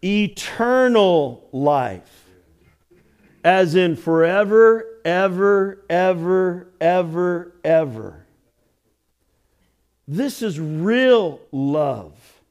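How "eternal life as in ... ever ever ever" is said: slow, louder stress on eternal; louder repeated ever building to the end; emphatic. Real, not acted. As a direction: stretch each ever, insistent. Emphatic correction, forever repeated.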